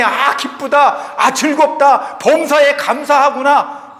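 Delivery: mock rejoicing. Preacher imagines Jesus rejoicing at our straying, hammed-up gladness. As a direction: proclaim joy in drawn-out exaggeration. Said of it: "예수님께서 그 시간에".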